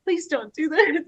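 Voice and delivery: high, laughing plea; laughing protest. Retold or imitated. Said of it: "And I'm like".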